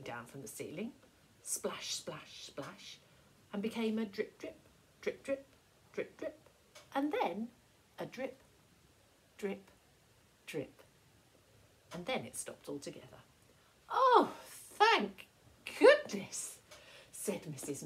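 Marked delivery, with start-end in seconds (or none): 1.4-10.8 fading drips. A narrator's reading. Dripping fades out gradually. 13.9-16.6 much higher and louder, hammed-up relief; relieved. Missus McNally relieved the water stopped, high and loud.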